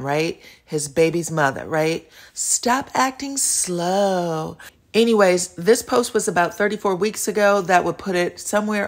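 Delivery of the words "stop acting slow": very slow, drawn-out mimicry; mocking imitation. Host mimics the baby's mother's pet word, mocking drawl. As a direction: stretch out SLOW in mock imitation. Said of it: "who likes to say the word slow".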